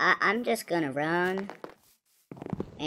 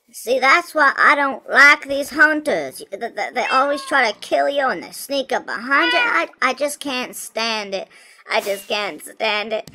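mock breakdown; 6 semitones higher, 5 dB louder than usual. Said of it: "I'm having an emotional breakdown".

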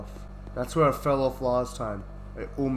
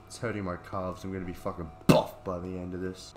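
normal talking voice

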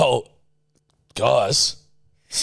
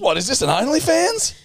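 much higher scandalised voice; high-pitched character; 13 semitones higher than usual. Acted. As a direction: shriek it up high in shock. Acted high character bit.

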